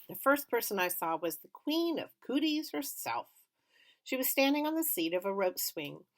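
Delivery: ordinary delivery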